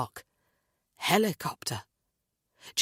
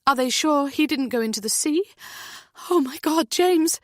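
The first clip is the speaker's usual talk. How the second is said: high, loud, frantic mother voice; a mother's voice rising in panic; panicked mother; 9 semitones higher, 5 dB louder than usual. A narrator's reading. Heather panics about missing son.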